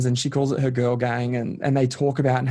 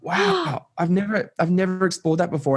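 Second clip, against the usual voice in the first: retold amazed wow, much higher in pitch; amazed discovery; 6 semitones higher than usual. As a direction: relive the moment of discovering a surprising word origin. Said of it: "And I was like".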